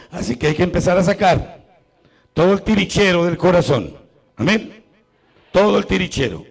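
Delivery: rising to a loud, high-pitched exhortation; preaching at full force. Acted. Priest urging the congregation to empty their hearts, preaching loudly. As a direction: drive it home to the congregation like a pulpit cry.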